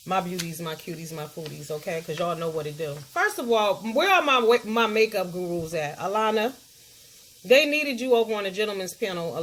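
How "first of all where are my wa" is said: ordinary talk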